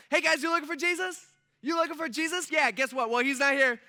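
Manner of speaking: high, loud, bursting with excitement; pumped angel. Pretend. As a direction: blurt out big news as a hyped angel. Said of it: "this angel's probably pretty pumped".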